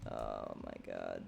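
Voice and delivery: very low, slow "oh my god"; deep slow voice. Pretend. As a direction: drop into a deep groaning voice.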